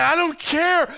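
loud, high mock defiance; defiant. Pastor mimics paying twelve dollars anyway, loud and defiant. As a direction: toss it off loudly, no regrets. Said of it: "But I say".

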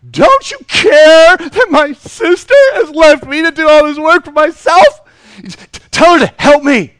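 high, loud, whining complaint; whiny complaining sister. Acted. Martha whining to Jesus about her lazy sister, high and loud. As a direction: nag Jesus like an overworked sister.

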